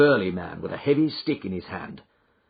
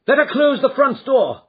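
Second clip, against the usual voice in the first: urgent shout, much higher and louder; cried urgently; 9 dB louder than usual. Acted. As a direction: call out the order. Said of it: "cried Holmes".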